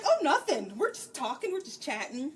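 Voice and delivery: loud, high, over-innocent denial; fake nonchalance. Fee hides the reading lesson from Tavin, exaggerated innocent denial. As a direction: blurt a hammed-up cover story, settling down as it goes.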